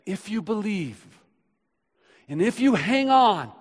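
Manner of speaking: swelling preacher cry; rising exhortation. Acted. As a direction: surge on the promise. Sermon surge.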